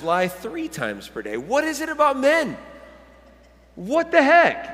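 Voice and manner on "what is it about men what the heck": mock exasperation. Preacher reacting to men lying more, mock-exasperated outburst. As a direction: protest in pitched-up bafflement.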